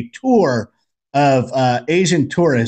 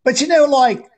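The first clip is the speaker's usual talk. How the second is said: Australian guy; 9 semitones higher than usual. Retold or imitated. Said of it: "he goes".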